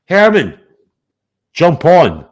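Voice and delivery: high, loud summons; calling out. Real, not acted. Streamer urging a rival to join the panel, calling out. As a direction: call him in, pitched high and loud.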